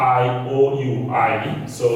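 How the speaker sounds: usual speaking voice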